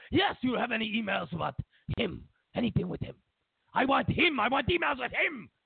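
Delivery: put-on high, insistent comic Russian accent; petulant demand. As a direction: build to a whiny, high demand. Putin demands the emails.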